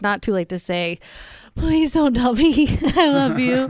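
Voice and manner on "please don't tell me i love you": mock plea. Mock pleading quote.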